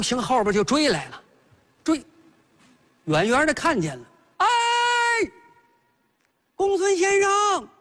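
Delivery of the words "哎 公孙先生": calling out from afar. Acted. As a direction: shout his name to someone far ahead, stretching it. Bao Xing calls after Gongsun.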